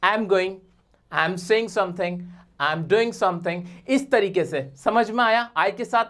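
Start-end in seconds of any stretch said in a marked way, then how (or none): 0.0-3.6 "I am going" run together as I'm going; I'm going, I'm saying, I'm doing, all clipped; contracted I am. Shown as a demonstration. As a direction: say "I am" as one short "I'm". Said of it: "contract करके एम पढ़ा जाता है".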